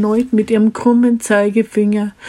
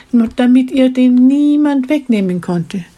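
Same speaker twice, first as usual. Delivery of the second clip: ordinary delivery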